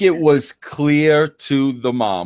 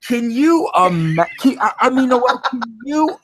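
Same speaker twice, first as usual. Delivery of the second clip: outraged sputter; 10 semitones higher than usual. Real, not acted. Cohost sputtering in disbelief at the kid's comment, high outraged voice. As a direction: pitch jumps up high in disbelief.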